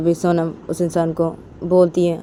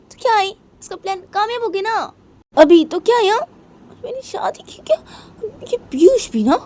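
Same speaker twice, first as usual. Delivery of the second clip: much higher, tearful character voice; upset Sonam voice; 4 dB louder than usual. Acted. Sonam complaining about her wedding, higher and tearful. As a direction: whine it out, close to tears.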